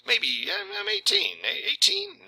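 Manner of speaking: high, hesitant, wavering pitch; mock unsure answer. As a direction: fake doubt about his own age. Arnold fumbling his claimed age.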